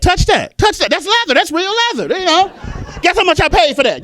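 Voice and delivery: high, loud, eager show-off; excited bragging. Acted. Thrift shopper showing off leather find, excited bragging.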